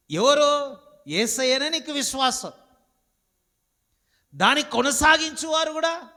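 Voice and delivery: high, loud, driving preacher's cry; fiery preaching. Acted. Preacher crying out to the congregation, high and loud. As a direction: hammer each question at the congregation.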